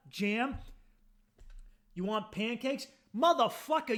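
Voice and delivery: usual speaking voice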